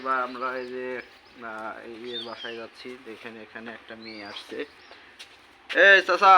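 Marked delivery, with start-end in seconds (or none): 5.7-6.3 raised, higher call to an uncle. Real, not acted. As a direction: hail him loudly across the yard. Hailing a neighbour to ask. (জোরে ডাক দেওয়া)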